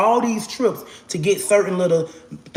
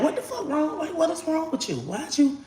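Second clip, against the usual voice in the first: high, hushed startled question; startled high exclaim; 11 semitones higher, 7 dB quieter than usual. Retold or imitated. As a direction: ask sharply, alarmed and high. Girls' shocked reaction when she falls.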